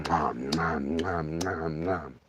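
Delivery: low, soft munching nom nom; bear chewing noises. Retold or imitated. Hunter imitating the bear chewing, low munching. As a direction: mimic a bear chomping dog food. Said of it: "it's going".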